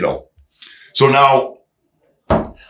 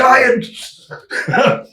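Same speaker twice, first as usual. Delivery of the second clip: ordinary talk, nothing marked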